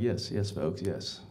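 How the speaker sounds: low, soft, self-satisfied yes; smug modesty. Retold or imitated. Frank answering the emcee, low and smug. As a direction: accept the praise coolly. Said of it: "I was like".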